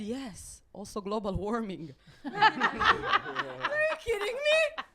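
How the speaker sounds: ordinary talk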